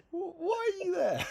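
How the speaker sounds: high, drawn-out baffled question; interviewer. Retold or imitated. Interviewer asking why a dubbed star is touring, high and incredulous. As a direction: stretch it out high, amused and incredulous. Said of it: "He goes".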